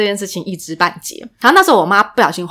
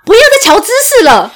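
mock pushy mom; 11 semitones higher, 5 dB louder than usual. Friend voicing a pushy mom.

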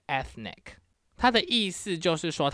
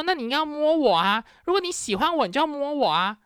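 much higher, whiny imitation of him; mocking the date; 9 semitones higher than usual. Vlogger mimics Greek date demanding touch, high mock voice. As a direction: squeak his demand like a spoiled man. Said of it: "他说".

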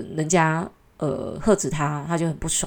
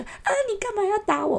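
put-on much higher, whiny baby-talk squeal; mock cutesy girlfriend. Girlfriend whining at her teasing boyfriend, hammed-up cutesy squeal. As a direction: play the coy girlfriend pretending to be hurt. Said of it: "然后那个女生就".

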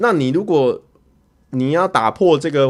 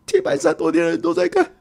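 higher again, pleading in mock despair; the second, more desperate repeat; rising panic. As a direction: push the panic up another notch. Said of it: "我就想说".

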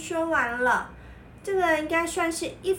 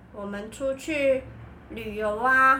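ordinary delivery

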